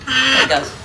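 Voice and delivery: calling the family dog over. Real, not acted. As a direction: call Gus over brightly.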